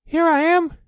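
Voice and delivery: very high, loud squeak; squeaky little Roo. A narrator's reading. Roo showing off his swimming, high loud squeak. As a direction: squeak it like a proud tiny joey. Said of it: "squeaked Roo".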